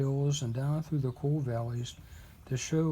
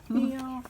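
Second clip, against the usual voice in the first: cat miaow; 10 semitones higher than usual. Pretend. Reader miaows once more.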